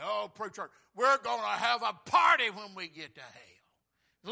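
high, full-force mocking shout; preacher hammering out a sinner's bragging; defiant boast. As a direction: bellow it like a cocky scoffer. Mocking men's boast, acted.

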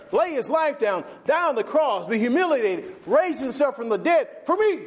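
high, rising preacher's build; preaching at full force. Acted.

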